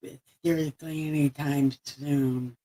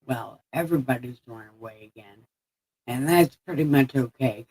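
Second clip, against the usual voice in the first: ordinary delivery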